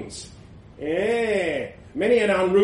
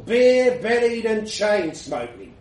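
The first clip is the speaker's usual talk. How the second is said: plain reading voice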